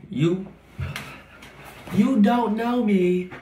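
mock shout, high and drawn out; loud high outburst. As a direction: belt it up high and slow. Sudden outburst line.

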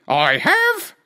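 very high clucky hen. Acted. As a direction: chirp it like a hen. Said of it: "replied the hen".

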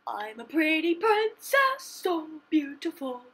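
high, slow dainty princess. Acted. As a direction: draw it out like a preening princess. Speaks as a pretty princess.

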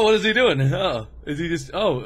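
normal talking voice

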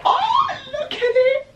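very high squeal through laughter; high, laughing squeal with a near-tearful edge. Real, not acted. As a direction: squeal with giddy delight at the print. Gushing over the bunny print.

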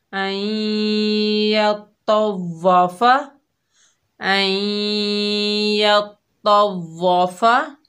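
यतवाफा chanted slowly, melodic; slow tajweed recitation of यतवाफा; recited slowly. Shown as a demonstration. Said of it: "दोनों को बगैर खींचे पढ़ेंगे".

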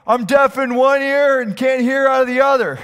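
high, booming deaf old man impression; deaf grandpa's loud joke. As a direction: shout it as if you can't hear yourself. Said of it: "doesn't know how loud he is".

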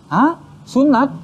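high-pitched, startled questioning; shocked father. Retold or imitated. Father hearing his son was circumcised, shocked. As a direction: imitate papa's disbelief, pitch jumping up.

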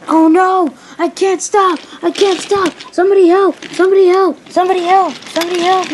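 high, loud cries for help; repeated frantic high shouts; panicked yell. Acted. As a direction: yell out as a runaway engine. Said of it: "yelled Percy".

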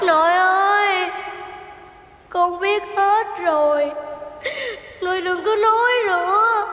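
earnest stage speech ending in a sung call; plead, then sing out the last words; heartfelt child actor ending in song; child pleading in role. Acted. Boy answers grandfather in role.

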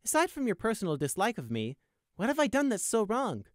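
aggrieved, lifted voice; challenging captive. A narrator's reading. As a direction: press him for an answer.

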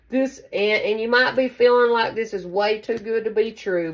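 ordinary delivery

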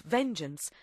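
usual speaking voice

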